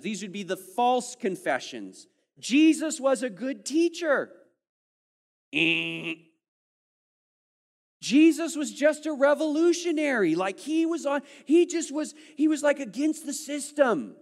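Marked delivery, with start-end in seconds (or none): 2.4-4.3 high, loud mock voice of a skeptic; mocking false claim. Preacher mocking a false confession, high and loud. 5.5-6.1 a wrong-answer buzzer sound; buzzer noise. Retold or imitated. Preacher rejecting a false confession with a buzzer noise. 8.0-14.2 high, loud mocking voice; mock skeptic claim. Preacher mocking a false confession, high and loud.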